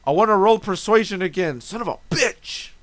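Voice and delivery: mock indignant demand; loud demand. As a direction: push hard for another roll. Player demanding reroll.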